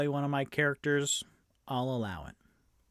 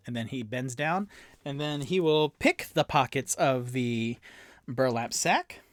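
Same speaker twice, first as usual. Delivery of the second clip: ordinary talk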